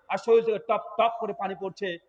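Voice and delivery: ordinary talk, nothing marked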